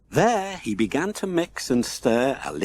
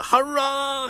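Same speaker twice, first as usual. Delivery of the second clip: much higher, cheering hurrah; joyful shout; 13 semitones higher than usual. A narrator's reading. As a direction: burst out with glee. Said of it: "he shouted".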